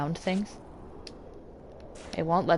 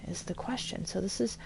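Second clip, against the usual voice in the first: hushed whisper so nobody wakes; breathy whisper, barely voiced; 3 semitones lower, 13 dB quieter than usual. Real, not acted.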